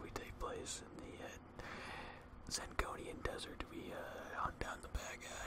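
slow, very soft whisper; ASMR whisper. Pretend. Host tells viewers the show's setting, whispering slowly. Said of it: "why are you whispering?".

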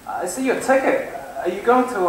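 ordinary talk, nothing marked

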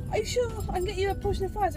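high, insistent coaxing; imitated insistent sister. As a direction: keep pressing the offer in a high voice. Retold sister keeps pressing.

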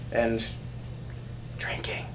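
slipped in as a hushed confession; whispered aside. Real, not acted. Fisherman confessing to the camera that they drink, whispered. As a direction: drop to a whisper like a guilty secret. Admitting drinking on fishing trips.

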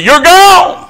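high emphatic cry. Real, not acted. Preacher to the congregation, a high, loud climax. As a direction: shout you're gone high and hard. Preacher hammering point home.

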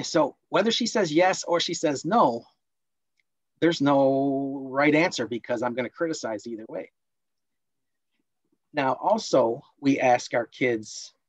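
normal talking voice

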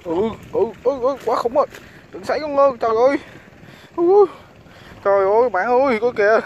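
high, loud excited yelps over a big catch; delighted loud shouts at a fighting fish; excited shouting. Real, not acted.

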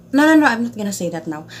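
rushed no, no, not telling; quick playful refusal; quick teasing refusal. Real, not acted. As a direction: brush it off fast and playful. Teasing, refusing to tell story.

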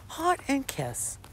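high, slow mock cooing; mock sweet read. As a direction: coo it like a lovesick texter. Mocking read of his emojis.

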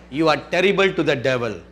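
usual speaking voice

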